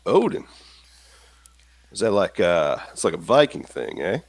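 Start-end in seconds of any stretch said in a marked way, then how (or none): 0.1-0.4 high, rising query; puzzled question. Acted. Slim hearing of the god for the first time, puzzled. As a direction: repeat the name as a baffled question.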